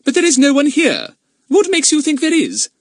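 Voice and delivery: high, loud stern lady; haughty mistress of the house. Acted. Narrator voices Mrs Trotville.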